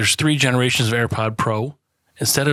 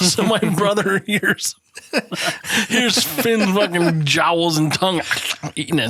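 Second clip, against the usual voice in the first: slow, higher, laughing between words; laughing storytelling; 6 semitones higher, slower than usual. Real, not acted. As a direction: barely get it out for laughing. Laughing while telling story.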